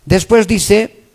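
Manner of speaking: loud, insistent preaching; full-force preaching. Acted. Preacher urging the congregation to know God, loud and forceful. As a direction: preach it out to the whole room, loud and driving.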